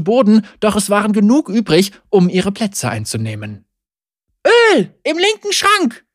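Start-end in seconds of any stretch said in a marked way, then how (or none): 4.5-6.0 high, urgent call; mother calling out. A narrator's reading. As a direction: shout it across the wagon. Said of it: "rief ihre Mutter".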